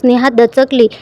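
ordinary delivery, nothing marked

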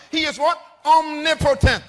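high, loud preacher's proclamation; preaching. Real, not acted. Preacher telling the congregation what omnipotent means, preaching.

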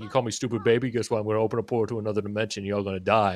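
a hint of a bratty villain; menacing baby Hoopa. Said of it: "He's like".

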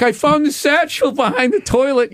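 boy showing mom the satchel, lightly voiced good boy. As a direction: act the helpful kid who found it. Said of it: "like, I'm a good boy".

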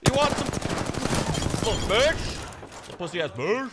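high, loud trash talk; aggressive taunting. Real, not acted. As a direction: taunt them like a bully. Trash-talking enemies during fight.

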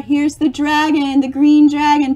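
ordinary delivery

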